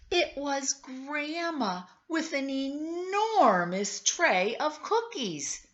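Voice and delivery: high, slow, building to the surprise; excited reveal. A narrator's reading. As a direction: unveil it with wide-eyed delight. Big reveal of Grandma.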